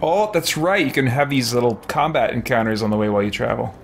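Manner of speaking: usual speaking voice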